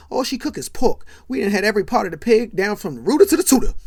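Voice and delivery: hammed-up complaint. [name] joking about Yvonne's cooking, loud hammed-up rant. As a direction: boom it out to the whole table.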